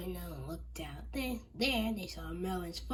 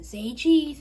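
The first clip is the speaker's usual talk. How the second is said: calling out; 8 semitones higher, 15 dB louder than usual. Acted. Calling out for photo.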